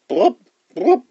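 high mouth-made pops; popping sound. Acted. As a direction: imitate two sticks popping out. Sticks pulled out of ears.

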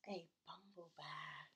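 name him gently, with wonder; tender reveal. Acted. Queen's tender naming reveal.

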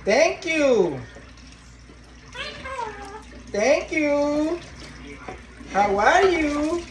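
high, drawn-out pet talk; prompting a parrot. Real, not acted. Owner teaching the parrot phrases, high drawn-out prompting. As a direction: stretch the words high for a bird to copy.

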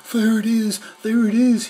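high, loud, delighted cry; happy dog spotting his lost collar, high and loud; gleeful. A narrator's reading.

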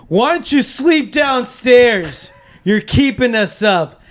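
high, loud, drawn-out shout; confident scolding yell. Acted. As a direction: yell it slowly at your dad. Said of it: "my confidence level started going lower".

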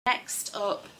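ordinary talk

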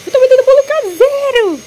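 high excited pitch to viewers; excited announcement. Real, not acted. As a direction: shout it out with delight, way up high.

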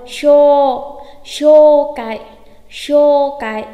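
"Sho" held long and loud; long vowel. Shown as a demonstration. Teacher drilling long vowels, "sho" loud and drawn out. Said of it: "kéo dài ra thôi".

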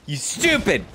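high, slow, drawn-out insult; mocking yell. Real, not acted. Gamer taunting a turtle-like boss, high drawn-out insult.

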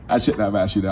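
regretful mom. Retold or imitated. Mom regretting her question to her son, low. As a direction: drop the pitch for a rueful mom. Imitates mom's regretful reply.

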